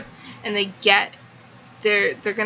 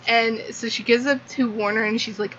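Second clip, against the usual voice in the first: ordinary talk, nothing marked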